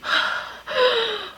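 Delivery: long high strained gasp for air; gasping breath demo. Shown as a demonstration. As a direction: show fighting to fill the lungs. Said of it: "doing something like that".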